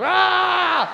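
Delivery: high, drawn-out yell of frustration; exasperated yell. Acted. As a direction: scream at a maddening customer. Exasperated vendor loses patience.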